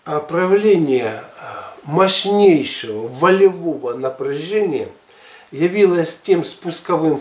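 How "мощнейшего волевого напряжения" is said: ordinary talk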